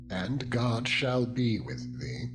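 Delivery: ordinary delivery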